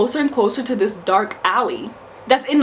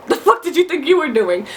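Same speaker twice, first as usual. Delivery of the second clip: bold confrontation; 5 semitones higher than usual. Retold or imitated. Facing down the guy in the alley, sharp and bold. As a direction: get in his face.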